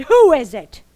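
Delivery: booming and official, slow on the last line; loudest, most official voice. Acted. Guardian of the well warning off a visitor, loud and official. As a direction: sound like the boss of the grove. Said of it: "in her loudest, most official voice".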